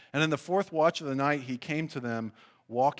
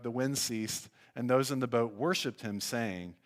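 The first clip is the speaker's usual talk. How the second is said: usual speaking voice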